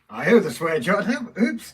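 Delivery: loud, high embarrassed yelp; caught-out oops. Real, not acted. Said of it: "Sorry about that, guys".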